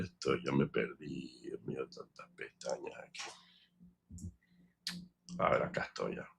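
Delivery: barely voiced mutter to himself, lost among tabs; under-breath muttering. Real, not acted. Man lost among browser tabs mutters to himself, barely voiced. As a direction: whisper it to yourself while hunting for the right tab.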